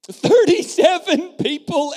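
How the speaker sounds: high, loud, slow exclamation; exclaiming. Real, not acted. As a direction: proclaim it in amazement. Preacher exclaiming attendance.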